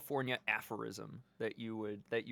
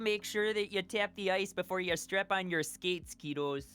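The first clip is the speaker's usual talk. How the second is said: put-on lightly raised Minnesota accent, folksy uncle; 4 semitones higher than usual. Friend lecturing kids about ice in a light Minnesota accent. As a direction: warn the kiddos in a Minnesota accent. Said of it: "It's very Minnesota".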